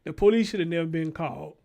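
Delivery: loud, heated yelling; shouting at the daycare staff; furious shout. Real, not acted. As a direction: let it rip at them.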